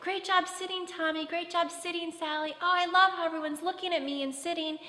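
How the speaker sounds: put-on lavish delighted praise on the sitting kids; gushing praise. Shown as a demonstration. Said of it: "you're like".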